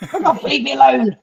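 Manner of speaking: hammed-up cowering from the needle; mock whimper. Patient pretending to shrink away from the nurse, whining high. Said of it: "You're just like".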